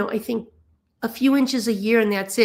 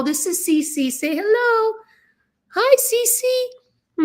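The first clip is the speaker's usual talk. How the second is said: much higher cooing pet voice; pet talk; 12 semitones higher, slower than usual. Real, not acted. Introducing her pet Cece.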